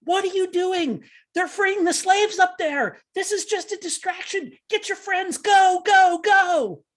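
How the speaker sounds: high, urgent warning that builds to a loud yell; urgent in-character shout. Acted. Player's character urges the charmed orc, rising to a loud, desperate shout. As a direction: raise the alarm and drive the orc to go. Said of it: "and I say".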